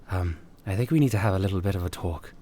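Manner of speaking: quiet, gentle therapist tone; soft therapist voice. Acted. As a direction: speak softly like a kind therapist.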